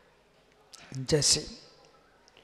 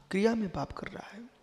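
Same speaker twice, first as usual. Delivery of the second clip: half-whispered, softer repeat of a critic's charge; whispered mock accusation; hushed accusation; 3 semitones higher, 6 dB quieter than usual. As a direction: murmur it under the breath, mock-scandalised.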